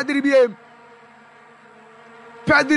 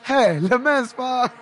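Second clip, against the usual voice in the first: ordinary talk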